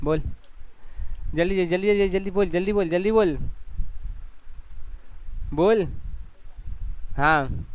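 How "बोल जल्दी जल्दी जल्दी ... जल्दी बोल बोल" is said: higher, insistent hurry-up chant; hurrying urging. Real, not acted. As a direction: rush the student to speak. Teacher hurrying student to speak. (जल्दी मचाती ऊँची आवाज़)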